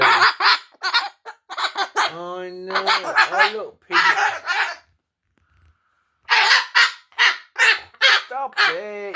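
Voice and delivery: very high giggling squeal; laughing squeal. Real, not acted. Owner laughs high at her screeching parrot. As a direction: squeal and laugh at the loud bird.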